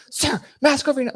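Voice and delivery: very high, loud mock order; imitated TSA yell. TSA lady six inches from passenger, mock high yell. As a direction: bark it as a worked-up official, sending her up.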